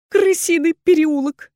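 high, slow, tearful cry; whimpering woman giving in; sobbed. A narrator's reading. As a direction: sob the answer out loudly. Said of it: "всхлипнула женщина".

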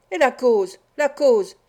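gloating interruption; triumphant. A narrator's reading. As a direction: pounce on the point you were waiting for. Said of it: "d'un air triomphant".

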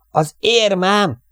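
high, loud, drawn-out cry; panicked yell. A narrator's reading. Airman finds his lucky medal gone, yelling. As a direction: yell it out in alarm, pitch way up. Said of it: "ordított".

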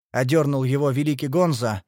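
usual speaking voice

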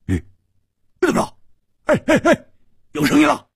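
very high, eager cries; excited. A narrator's reading. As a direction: call out thrilled at hearing something. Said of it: "蝴蝶花说". (兴奋地尖声叫)

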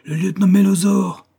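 high, loud collective cry; crew's exclamation. A narrator's reading. The crew recognises its lieutenant, crying out. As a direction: call out the name as one crew. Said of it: "dit l'équipage d'une seule voix".

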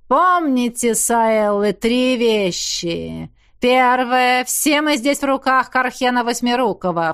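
slow, loud, sinister villain pronouncement; menacing count, savoring every word; mustache twirling villain. Acted. Count speaks to frightened brides.

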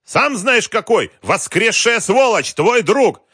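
very high, loud, enraged yell; furious shouting. A narrator's reading. Wolf ordering the general to bring money, shouting. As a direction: shout back, stretching the furious words. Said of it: "крикнул Вольф".

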